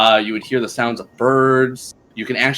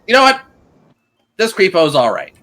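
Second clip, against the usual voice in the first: higher-pitched character voice, loud start easing off; perky approval, pitched up; [name] in his voice; 7 semitones higher, 5 dB louder than usual. Acted. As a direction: call it out high, then settle. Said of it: "[name] talked in his voice".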